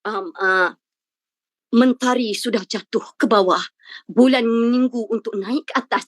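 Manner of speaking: hammed-up poetic recitation, slowed; mock-poetic answer nobody should give; mock flowery speech. Shown as a demonstration. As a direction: overdo the flowery language as a joke. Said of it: "tak perlu berbunga-bunga".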